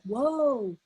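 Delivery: a quick caught-yourself whoa, mildly voiced; startled inner whoa. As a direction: catch yourself mid-reaction, light and brief. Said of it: "I have to check in myself, like".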